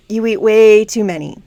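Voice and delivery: ordinary talk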